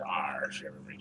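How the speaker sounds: low, soft pirate growl. Pretend. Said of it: "I'm gonna use the pirate voice".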